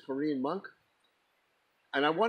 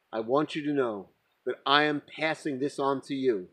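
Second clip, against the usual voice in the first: ordinary talk, nothing marked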